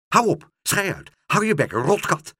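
loud, high, furious yelling; furious shout. Acted. Pluk screams at the cat to shut up, furious shouting. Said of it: "schreeuwde Pluk woedend".

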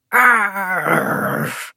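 long rolled pirate "Arr"; pirate growl. Acted. Bink draws his sword as a pirate, growling. Two children play at pirates.

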